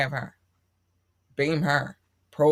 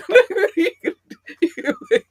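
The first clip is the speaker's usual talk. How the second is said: high laughing squeal; amused squeal; 7 dB louder than usual. Real, not acted. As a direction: giggle it out, slowly. Laughing at imagined panic.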